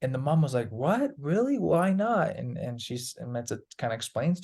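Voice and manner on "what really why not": high, disbelieving questions; mock surprise from the mom; surprised mom. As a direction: sound genuinely puzzled.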